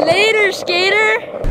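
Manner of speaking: slow singsong farewell; drawn-out goodbye. Real, not acted. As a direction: stretch the words out slowly. Playful goodbye rhyme.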